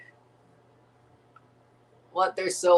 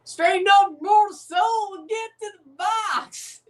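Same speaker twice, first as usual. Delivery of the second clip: much higher, shouted mock command; mock divine command; 11 semitones higher than usual. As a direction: order the mortal around, theatrically.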